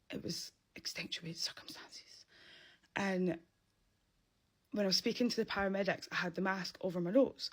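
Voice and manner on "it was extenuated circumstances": half-whispered, slow, soft aside; hushed aside. Real, not acted. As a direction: drop to a slow whisper, excusing yourself. Jokey self-justifying aside.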